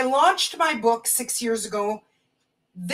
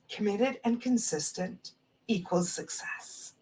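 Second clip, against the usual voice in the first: soft emphatic mantra; 2 semitones lower, 9 dB quieter than usual. Real, not acted. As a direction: let the words sink in quietly. Repeating the oracle's key message.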